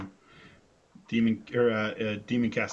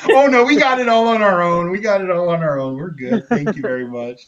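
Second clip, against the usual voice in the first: higher, louder, overly sweet polite refusal, laughing through it; Mister Rogers; 7 semitones higher, 11 dB louder than usual. Retold or imitated. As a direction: brush them off with a beaming TV-host sweetness. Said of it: "immediately becomes like Mister Rogers".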